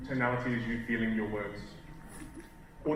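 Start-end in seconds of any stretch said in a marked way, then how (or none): 0.1-1.6 flat, low and soft, no feeling; toneless, emotionless delivery; no tonality. Shown as a demonstration. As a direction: say it evenly with every word unstressed. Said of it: "say the same thing with no tonality".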